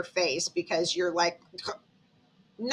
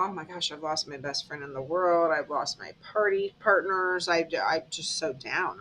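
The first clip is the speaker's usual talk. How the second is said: low, subdued lament; downcast; 5 semitones lower, 4 dB quieter than usual. Acted. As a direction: sound a little down, lower and softer. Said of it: "you're sad and you're down".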